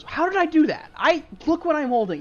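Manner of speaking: high, loud baffled protest; baffled ally. Acted. Ally holding the box protests, high and bewildered. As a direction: plead innocence while holding the box. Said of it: "He goes".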